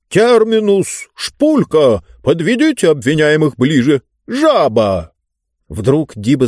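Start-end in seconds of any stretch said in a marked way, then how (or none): 0.1-5.1 raised, commanding tyrant voice; shouting out words. A narrator's reading. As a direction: call out orders like a pompous ruler. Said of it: "выкрикивая слова".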